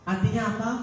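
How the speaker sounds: normal talking voice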